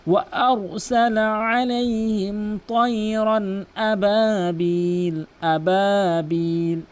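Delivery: slow, long-drawn tajweed chant; measured Quranic chanting; Quran recitation. Acted. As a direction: recite with stretched, sustained vowels.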